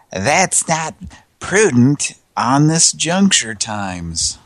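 lightly drawn Bush, words stretched out; George Bush impression. Host sending up a comedian's Bush impression, slow. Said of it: "George Bush impersonation".

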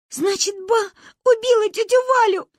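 high, loud tearful cry. A narrator's reading. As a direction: wail it through tears. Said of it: "сквозь плач". (сквозь слёзы)

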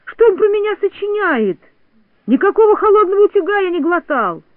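high, loud protest; indignant shouting. A narrator's reading. Toropyzhka denies swallowing the iron, shouting indignantly. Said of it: "закричал Торопышка".

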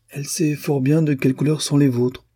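amused, knowing reply; smiling. A narrator's reading. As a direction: tease him with a smile. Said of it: "répliqua la princesse en souriant".

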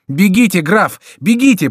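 high, loud urging; urgent warning. A narrator's reading. Remy urges the count to escape, high urgent cry. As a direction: cry out to make him flee.

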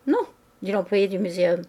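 usual speaking voice

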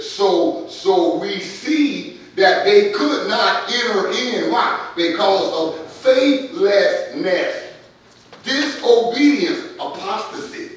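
loud, slow, drawn-out preacher cadence; hammering the point home to the congregation; full-force preaching. Acted. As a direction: preach it out, leaning on every word.

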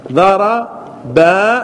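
ضربا stretched with a rising end; slow drawn-out demo. Shown as a demonstration. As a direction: hold the final alif long. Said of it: "teacher drawing out verb form". (مد ضربا ببطء مع صعود)

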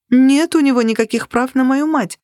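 crying out. A narrator's reading. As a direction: shout the protest without going full force. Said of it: "выкрикнула я".

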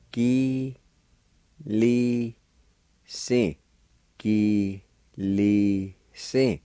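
"Gi, li, si" drawn out, a pause after each syllable; slow syllable-by-syllable. Shown as a demonstration. Teacher breaking down "Gi, li, si" for learners, slow and separated. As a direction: sound out "Gi, li, si" slowly, one syllable at a time. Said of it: "pronounce them out kinda slow".

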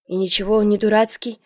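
plain reading voice

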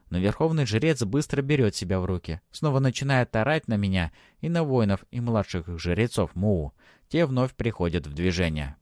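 usual speaking voice

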